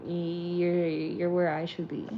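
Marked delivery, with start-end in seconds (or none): none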